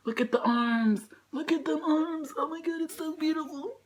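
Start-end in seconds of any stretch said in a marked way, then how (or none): none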